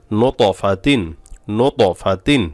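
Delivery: নো ত ফা তিন with the ত echoed; qalqalah bounce. Shown as a demonstration. Teacher drills the letter ta, qalqalah on ত. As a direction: bounce the heavy ত after নো. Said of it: "ততে কলকলা হবে".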